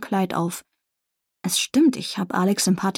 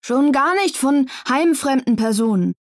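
higher, prim busybody chiming in; fussy official voice; 5 semitones higher than usual. Acted. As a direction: play the self-important dorm manager. Fussy dorm manager chimes in. (pedantischer Heimleiter, etwas höher)

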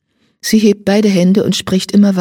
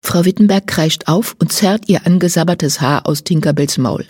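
plain reading voice

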